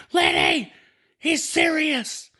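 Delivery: very high, squeaky mock desperation; mock urgent plea. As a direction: squeal it out as a drawn-out warning to a friend. Retold urgent plea to joking friend.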